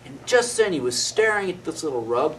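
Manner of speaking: ordinary delivery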